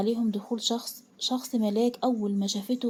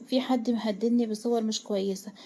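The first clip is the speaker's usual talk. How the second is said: ordinary delivery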